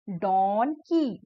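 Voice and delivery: slow donkey, split as don, kee; broken into syllables. Shown as a demonstration. Teacher breaking a word for children, slow syllable-by-syllable donkey. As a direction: stretch donkey into two separate beats. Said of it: "break करके बोला गया है".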